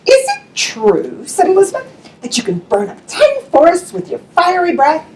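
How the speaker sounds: high, sweet flattering princess; a clever princess buttering up the dragon. Acted. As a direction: coax the dragon with a high, sugary voice. Princess voice in read story.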